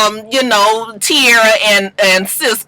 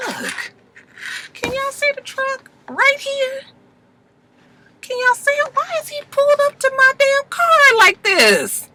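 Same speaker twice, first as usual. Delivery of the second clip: high-pitched, incredulous complaint; real exasperation; 3 dB quieter than usual. Real, not acted. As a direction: squeal it in disbelief. Annoyed at car pulled up close.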